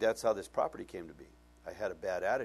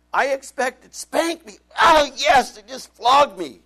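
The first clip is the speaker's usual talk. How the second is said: much higher and louder, near shouting; overwhelmed cry; 14 semitones higher, 17 dB louder, slower than usual. Real, not acted. Pastor tells the congregation how grace floored him, near shouting. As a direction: cry out as if undone.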